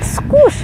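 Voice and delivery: high squeaky squish; squish noise. Retold or imitated. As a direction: make a tiny squish sound. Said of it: "it would be like".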